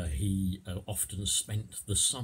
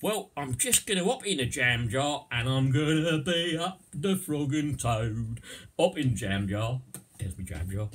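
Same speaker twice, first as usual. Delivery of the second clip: raised, loud Cockney character; Cockney accent; 6 semitones higher, 5 dB louder than usual. Shown as a demonstration. Cockney off to his car and the road, broad accent. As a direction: chatter as a hammed-up Cockney. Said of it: "I'll do the first bit in Cockney".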